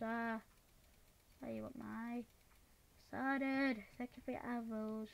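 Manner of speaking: ordinary talk, nothing marked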